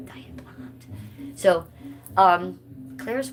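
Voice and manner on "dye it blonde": hushed whisper, almost secret; whispered aside. Real, not acted. Whispering a tip to Claire about blonde dye. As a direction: lean in and whisper the advice.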